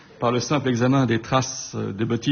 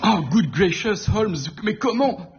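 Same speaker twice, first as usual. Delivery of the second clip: Watson stupéfait; 8 semitones higher than usual. Acted. Watson stupéfait, exclamation anglaise.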